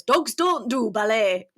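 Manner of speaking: slow, broad Yorkshire dad; drawn-out Yorkshire accent from a story reading. Shown as a demonstration. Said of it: "is always very Yorkshire".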